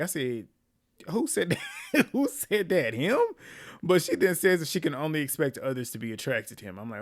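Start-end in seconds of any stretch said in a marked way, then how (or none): 1.1-3.2 laughing disbelief, higher. Real, not acted. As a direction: ask it while cracking up. Laughing at the contestant's claim.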